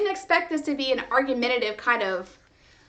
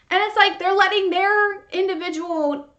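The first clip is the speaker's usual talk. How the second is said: ordinary talk, nothing marked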